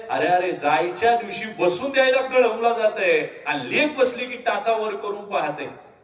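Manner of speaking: ordinary delivery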